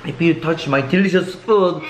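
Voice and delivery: ordinary talk, nothing marked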